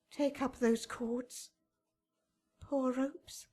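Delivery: grieving whisper. Acted. Juliet grieving over the rope ladder.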